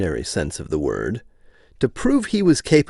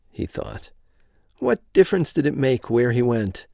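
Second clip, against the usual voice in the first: ordinary delivery, nothing marked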